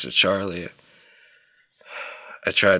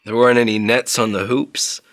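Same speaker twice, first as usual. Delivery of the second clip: ordinary talk, nothing marked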